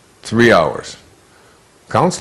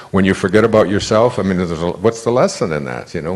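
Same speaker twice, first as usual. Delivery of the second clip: ordinary delivery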